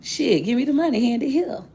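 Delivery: high, loud, eager grab at the cash; snatch the money gladly; excited high shout. Retold or imitated. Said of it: "And I said".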